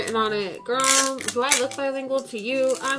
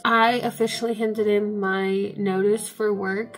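normal talking voice

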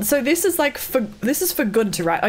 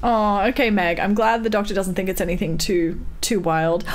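ordinary delivery

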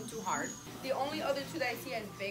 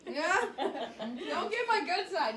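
laughing; 8 semitones higher, 5 dB louder than usual. Real, not acted. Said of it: "No, I'm just kidding".

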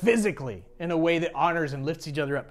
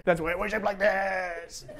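mock strained worshipper; 2 semitones lower, 6 dB quieter than usual. Worshipper showing how he now worships, mock strained. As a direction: squeeze it out in a hammed-up strain.

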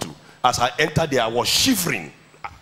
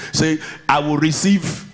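call-and-response preaching. Acted. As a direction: lead the church in a shout. Leads congregation in declarations.

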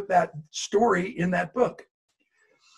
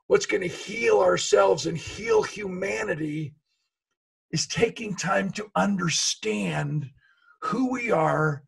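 slow solemn emphasis; slower than usual. Real, not acted. Solemn message to the camp.

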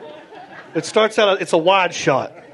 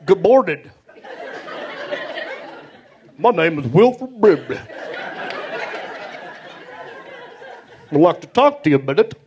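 lightly voiced TV-commercial pitchman; a hint of a folksy ad spokesman; Wilford Brimley. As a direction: deliver it as a halting commercial introduction. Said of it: "he's sitting there all brimbleyed up".

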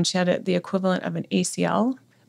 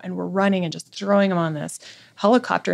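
ordinary talk

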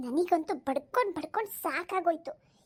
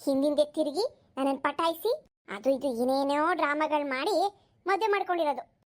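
ordinary talk

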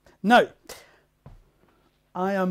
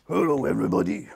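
lower, fast silly dog voice; 6 semitones lower, 5 dB louder, faster than usual. Pretend. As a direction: speak for the dog, playful. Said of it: "Say hello to the camera, doggy".

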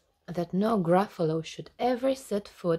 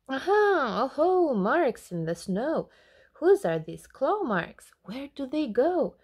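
excited discovery; 6 semitones higher than usual. Acted. Gruffalo's child finding claw marks, high and excited. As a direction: call it out thrilled.

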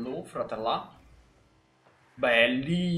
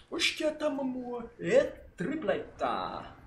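breathless italian goal commentary; 7 semitones higher, much slower than usual. Pretend.

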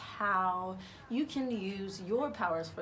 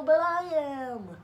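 booming, slower announcer delivery; 7 semitones higher, 7 dB louder, slower than usual. Pretend. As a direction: drag it out like a show host. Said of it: "speaking like an announcer now".

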